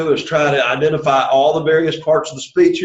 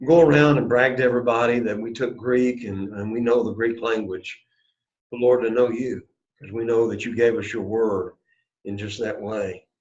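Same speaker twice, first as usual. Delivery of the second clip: earnest spoken prayer; 5 semitones lower, 4 dB quieter than usual. Real, not acted. Teacher praying for the class, earnest prayer. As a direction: pray it humbly to the Lord.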